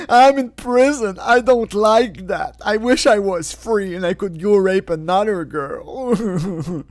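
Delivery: high, slow whiny voice; hammed-up self-pity; mock whining inmate. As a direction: whine like a spoiled child.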